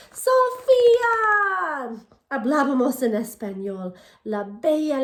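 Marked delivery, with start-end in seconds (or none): 0.1-2.1 high, loud, drawn-out call of the name; teacher's shout. A narrator's reading. Teacher yelling at two chatting pupils, drawn-out shout. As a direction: stretch the name out in a classroom yell. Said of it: "hasta que la maestra gritó".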